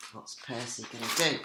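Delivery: usual speaking voice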